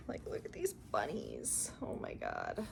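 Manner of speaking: hushed whisper over the bunnies; soft excited whisper. Real, not acted. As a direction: breathe it out softly, no voice.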